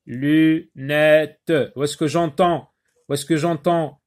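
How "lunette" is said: "lunette" in separate loud beats, lu, nè, te; split into syllables. Shown as a demonstration. Teacher repeating the division for pupils, split into syllables. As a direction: break "lunette" after each syllable, sounding the final te. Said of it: "on décompose le mot en syllabes".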